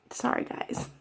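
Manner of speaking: tearful, barely voiced apology; fighting back tears; breathy apology while crying; choked up apology. Real, not acted. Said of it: "I'm so emotional now".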